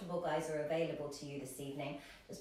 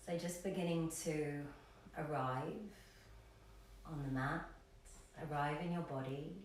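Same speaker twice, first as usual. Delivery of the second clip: soft, lower voice with long pauses; guided meditation; 3 semitones lower, 6 dB quieter than usual. Acted. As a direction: ease them gently into stillness, leaving space between phrases.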